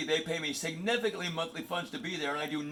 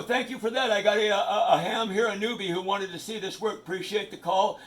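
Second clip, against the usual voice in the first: radio operator; 4 semitones higher, 3 dB louder than usual. Real, not acted. Ham radio reply on air.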